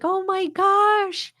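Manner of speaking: high, slow melting "oh my gosh"; touched coo. Retold or imitated. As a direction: melt at a puppy's lick. Dog licked her hand.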